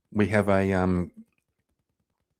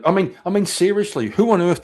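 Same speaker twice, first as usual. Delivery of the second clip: exasperated; 9 semitones higher, 6 dB louder than usual. Real, not acted. Ranting about the clip.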